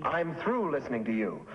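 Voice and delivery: ordinary delivery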